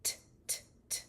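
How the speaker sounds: whispered "t, t" with open lips; T sound. Shown as a demonstration. Therapist contrasting T with ch. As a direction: tap the t without pursing. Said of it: "when I'm making letter T".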